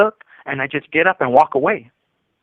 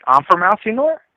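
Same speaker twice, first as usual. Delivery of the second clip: higher, challenging question; incredulous comeback, pitched up at the end; retold snapping; 6 semitones higher, 4 dB louder than usual. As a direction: toss it back at them as a challenge. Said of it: "I do snap at them".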